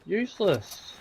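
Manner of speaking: usual speaking voice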